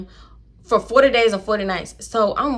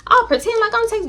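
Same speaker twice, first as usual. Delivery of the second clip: put-on high, squealy girlfriend voice; mock commenters. Imitating viewers in the comments agreeing, high and squealy. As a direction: squeal it like an excited follower. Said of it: "y'all get in the comments like".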